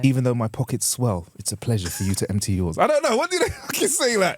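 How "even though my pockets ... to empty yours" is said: a hammed-up Bridgerton gentleman, low and unhurried; flowery period line, dropped low and slowed; mock period courtier. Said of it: "How would you say that?".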